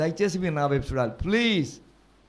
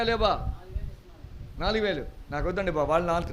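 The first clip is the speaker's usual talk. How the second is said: ordinary talk, nothing marked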